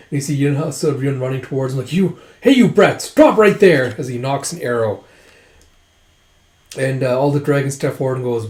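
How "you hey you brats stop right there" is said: loud, high shout at runaways; retold threatening yell; acted angry halt order. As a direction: bellow for the kids to halt.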